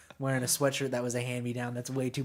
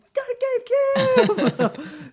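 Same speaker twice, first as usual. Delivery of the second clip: high, slow, gleeful announcement; excited brother; 8 dB louder, much slower than usual. Retold or imitated. Brother bringing the new console home, thrilled. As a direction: crow with delight.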